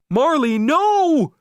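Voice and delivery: high, loud, slow frantic shout. Acted. Owner shouting at his dog on the beach, loud and frantic. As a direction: yell at the dog to stop. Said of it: "I shouted".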